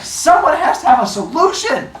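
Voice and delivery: high and loud mock desperation; desperate demand. Zeus demanding help.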